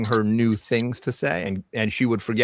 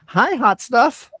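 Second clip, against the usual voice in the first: high, slow doll voice; sultry toy doll voice; talking doll; 7 dB louder, much slower than usual. Acted. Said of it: "she says".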